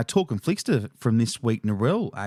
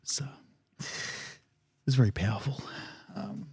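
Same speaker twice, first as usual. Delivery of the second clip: tearing up; 3 semitones lower, 6 dB quieter, faster than usual. Real, not acted. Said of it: "I can see you're already tearing up".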